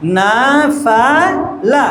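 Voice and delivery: "না ফা লা" slowly, syllable by syllable; slow separated reading of "না ফা লা"; slowly at first. Shown as a demonstration. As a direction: stretch না, ফা, লা with gaps between. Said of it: "আস্তে আস্তে বলবে প্রথমে".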